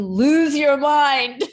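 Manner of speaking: loud, drawn-out, hammed-up wail; mock-crazed cry. Author joking about her own nervous breakdown, mock-crazed wail. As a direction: belt it out slowly, comically unhinged.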